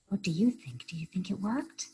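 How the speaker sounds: usual speaking voice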